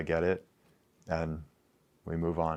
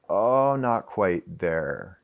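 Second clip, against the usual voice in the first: slow hesitant musing; much slower than usual. Retold or imitated. Voicing an inner hesitant feeling.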